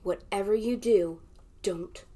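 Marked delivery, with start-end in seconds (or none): 1.6-2.0 slow, soft whisper; whispered warning. Acted. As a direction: warn them in a hush. Warning children teasingly.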